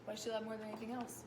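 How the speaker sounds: plain reading voice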